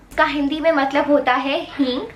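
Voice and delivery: normal talking voice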